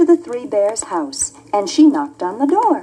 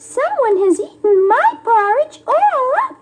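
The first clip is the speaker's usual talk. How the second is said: much higher little cub, a touch sad; a wee bear upset his porridge is gone; high baby bear, mildly sad. Acted. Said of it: "said the Baby Bear sadly".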